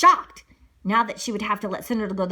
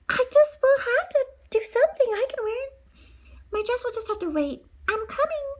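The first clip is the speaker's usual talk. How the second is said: high heroine voice; 14 semitones higher than usual. Acted. Cinderella answering the call.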